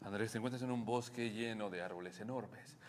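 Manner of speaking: soft, low meditation guide voice; guided meditation. Pretend. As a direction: lull him into the forest scene. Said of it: "que te dijeran, imagínate que estás en un bosque".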